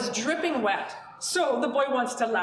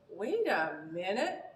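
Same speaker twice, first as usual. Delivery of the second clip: surprised pause; 4 semitones lower, 5 dB quieter, slower than usual. A narrator's reading. Sudden idea mid-sentence.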